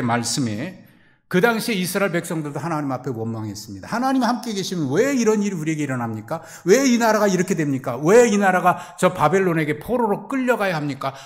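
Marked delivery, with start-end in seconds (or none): 3.9-11.1 loud, high grievance hurled at God; Israel's people complaining to God in a loud, high voice; resentful complaint. Acted. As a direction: protest loudly why this happens. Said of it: "원망하고 불평할 때".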